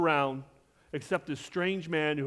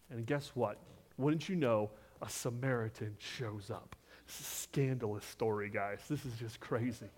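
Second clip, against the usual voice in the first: scandalized hush; 6 semitones lower, 7 dB quieter than usual. Acted. Preacher hushes over scandalous Samaritan.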